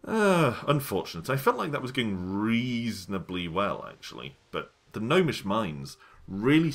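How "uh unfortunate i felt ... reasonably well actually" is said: normal talking voice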